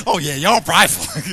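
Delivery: put-on sassy teasing girls' voice; mock girls' voice. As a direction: tease the guys like the girls do. Girls mocking guys' pride.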